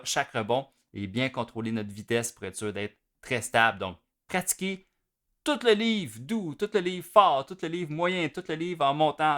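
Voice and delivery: ordinary talk, nothing marked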